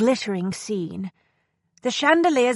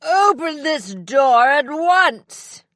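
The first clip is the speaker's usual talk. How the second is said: slow, higher, louder furious order; angry demand; 6 semitones higher, 4 dB louder, slower than usual. A narrator's reading. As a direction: drag out the command in outrage. Locked-in gentleman pounding on door.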